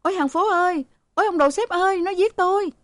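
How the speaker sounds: very high panicked shrieks; shrill screaming. Acted. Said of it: "Cả ba đứa con gái cùng the thé".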